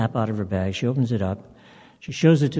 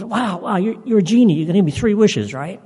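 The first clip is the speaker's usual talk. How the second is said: high, loud, breathless excitement at finding a genie; all excited; 10 semitones higher, 6 dB louder than usual. Retold or imitated. Woman thrilled to meet the genie, squealing excitedly. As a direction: gush like you can't believe your luck. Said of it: "And she's all excited".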